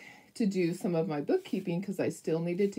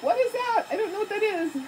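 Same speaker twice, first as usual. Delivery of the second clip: much higher and louder, flustered; flustered panic; 4 dB louder than usual. Retold or imitated. Answering the emergency vet in a high, flustered panic. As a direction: panic at not knowing the calculator. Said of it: "I'm like".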